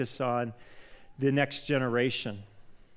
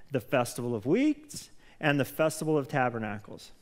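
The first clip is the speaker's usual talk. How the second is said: scripture reading; 2 semitones higher than usual. Acted. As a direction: read the verse out to the church. Reads scripture aloud.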